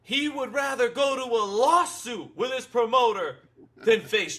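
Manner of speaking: hammed-up quote of a fighter dodging; mock fighter. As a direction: sneer it out like the fighter. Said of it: "and he's like".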